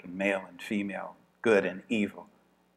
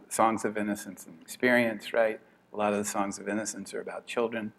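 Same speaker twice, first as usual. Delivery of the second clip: ordinary talk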